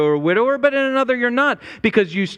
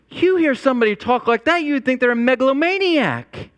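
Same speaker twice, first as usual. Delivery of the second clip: usual speaking voice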